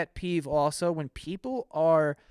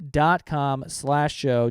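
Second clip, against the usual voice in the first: slow repeat; 2 semitones lower, much slower than usual. Shown as a demonstration. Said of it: "repeating link slowly".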